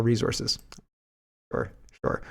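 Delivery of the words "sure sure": breathy sarcastic sure, sure; sarcastic whispered aside. Real, not acted. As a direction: whisper it dismissively.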